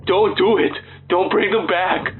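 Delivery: high, frantic pleading cry; panicked scream. Acted. Injured astronaut screaming warnings at his rescuer in panic. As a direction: beg them in terror not to go back.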